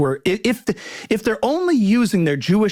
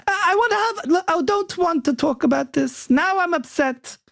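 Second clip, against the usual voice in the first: mock neurotic whine; 11 semitones higher than usual. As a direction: play an anxious hypochondriac, pitched way up. Mocking anxious caricature.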